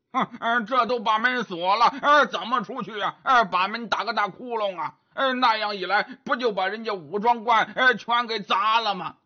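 pig-man voice, grunting between phrases; grunting Zhu Bajie, grumbling. Acted. Bajie asks how they get past the locked door, grunting grumble. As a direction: grumble and snort like a doubting pig.

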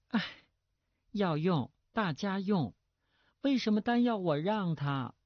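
put-on high girlish complaint; deliberately needling daughter. A narrator's reading. Daughter complaining to uneasy father, pointed and high. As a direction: needle father in a pampered girl's voice. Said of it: "故意再加一句".

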